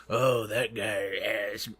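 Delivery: slow, strange ghoul voice. Pretend. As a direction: drag it out like a ghoul. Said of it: "let me get my ghoul voice".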